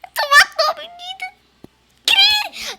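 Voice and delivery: high, loud wailing shock; shocked cry. Real, not acted. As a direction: wail it in disbelief. Friend killed the boy.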